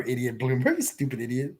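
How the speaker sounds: ordinary delivery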